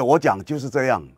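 ordinary talk

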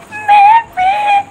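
very high, slow squeak; squeaky voice. Acted. Friend admitting she's cursed too, in a very high squeak. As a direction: stretch it out in a tiny cartoon squeak. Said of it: "तुम्हारी आवाज़ को क्या हो गया".

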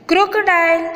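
ક્રોકોડાઇલ held high; teacher's high model ક્રોકોડાઇલ; English pronunciation demo. Shown as a demonstration. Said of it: "અંગ્રેજી ઉચ્ચાર થશે".

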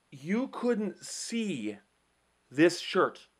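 very slow, drawn-out disbelief; softly astonished, stretched out; incredulous. Retold or imitated. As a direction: retell it as a stunned question, word by word. Retelling his disbelief at the driver.

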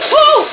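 loud, high "oh"; startled yelp. Real, not acted. Player startled mid-game, loud high yelp. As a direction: yelp out in sudden surprise.

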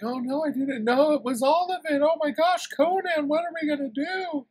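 very high, loud hysterical falsetto; over-the-top shrieky damsel in distress; panicky damsel. Retold or imitated. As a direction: squeal in a mock-panicked girl voice. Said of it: "she's like".

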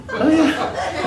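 high, light "Oh, yeah" imitating the wife; mock high coo. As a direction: coo it like a soft-hearted mum. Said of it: "My wife would kinda like".

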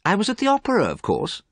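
high start dropping to an offhand end; mildly bored dismissal. A narrator's reading. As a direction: toss it off as if bored. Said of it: "Dorian sounded very bored".

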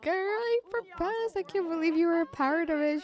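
high gushing friend voice; lightly voiced excited friend; voicing Annabelle. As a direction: gush like a thrilled best friend. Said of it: "She's like".

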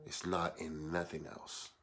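low, very soft reverent delivery; hushed preaching. Real, not acted. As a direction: bring the sermon down to a hush. Hushed settling after preaching.

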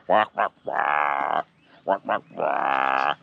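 slow drawn-out crow caws; mock the crow's cawing; imitating crow caw. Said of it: "it's gonna go".

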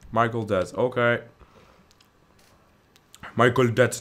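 ordinary talk, nothing marked

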